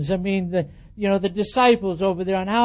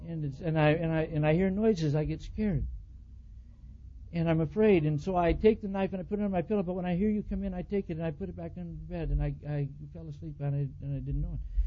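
much softer, small frightened voice; timid confession; 2 semitones lower, 10 dB quieter than usual. Retold or imitated. Wife softly admits she keeps a knife from fear. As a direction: confess it meekly.

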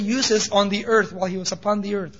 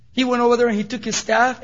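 usual speaking voice

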